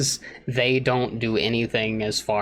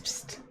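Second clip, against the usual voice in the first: quiet hissed psst; psst sound; 14 dB quieter than usual. Acted. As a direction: psst to get attention. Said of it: "I was being psst".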